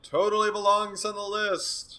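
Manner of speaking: high-pitched, emphatic endorsement; emphatic insistence. Real, not acted. Co-host pushing a game onto the list, emphatic insistence.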